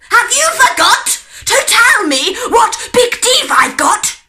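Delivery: loud, high shouting wolf. A narrator's reading. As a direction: roar it at the girl. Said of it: "cried the wolf".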